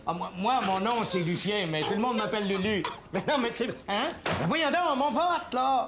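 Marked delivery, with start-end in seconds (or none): 4.2-5.9 high, agitated protest; indignant protest. Acted. Man losing his vote to the colleague who hung up, indignant protest. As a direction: plead in a raised pitch.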